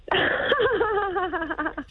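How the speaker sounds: high squealing giggle on the phone; a bright, high burst of laughter. Real, not acted. Caller laughing before her impression: high giggle. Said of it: "Is that the impersonation?".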